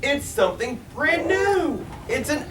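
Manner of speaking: high, loud delighted wonder; amazed crowd. A narrator's reading. Crowd cheering the elephant bird, high delighted wonder. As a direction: exclaim it as a marvel. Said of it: "they shouted".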